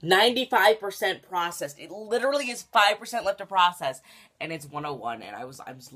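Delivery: normal talking voice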